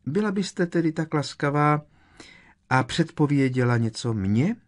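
high, sugary polite request; sweetly smiling. A narrator's reading. Professor asking the seer for a prediction, falsely sweet. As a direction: ask loudly through a fixed smile. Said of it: "s tímtéž úsměvem ve tváři".

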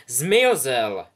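loud, falling call of the house; hat's loud announcement. Acted. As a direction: proclaim it to the hall.

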